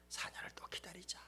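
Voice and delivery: 조용히 속삭이듯; 다독이듯 숨소리로 속삭여; 속삭임. Acted. 선수 마음을 속삭이듯 대변. (선수 마음을 대신 속삭이는 목사)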